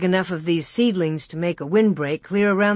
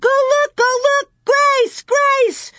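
put-on very high, frantic child's scream; 5 dB louder, slower than usual. A narrator's reading. Carrie demanding Laura search for Grace, screaming. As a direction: scream at her to act. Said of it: "Carrie screamed".

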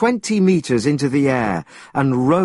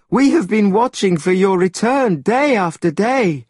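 high, loud, worried pleading; anxious little monkeys; 7 semitones higher, 4 dB louder than usual. A narrator's reading. The monkeys telling their king of the demon, high and worried. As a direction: crowd round and pour out fears.

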